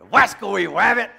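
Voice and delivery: loud, high cartoon voice with r as w; mock cartoon grumble at a rabbit; cartoon rabbit hunter. Preacher jokes about his runaway rabbit, cartoon hunter voice.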